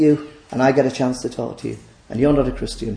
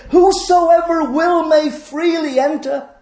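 high, loud, drawn-out proclamation; calling out; 5 dB louder, slower than usual. Acted. Preacher reading the sign on the gate to all, calling out. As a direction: cry it out slowly to all comers.

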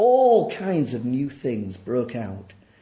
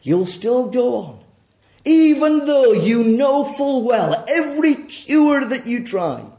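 raised, ringing sermon voice; forceful preaching; 10 semitones higher, 10 dB louder than usual. Acted. Preacher pressing the congregation to admit their sin, forceful. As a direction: press the hard truth on the congregation.

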